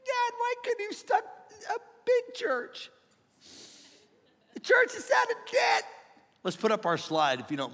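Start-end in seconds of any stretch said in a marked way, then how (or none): 0.1-5.8 very high, squealing lament to God; mock whining complaint. Pastor complaining God struck the church, mock whining. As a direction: whine up at heaven in a tiny high voice.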